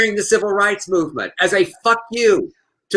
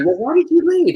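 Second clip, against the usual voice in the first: much higher, mimicking a curious asker; mock questioner; 12 semitones higher than usual. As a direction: toss off the question in a high put-on voice. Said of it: "when people ask me".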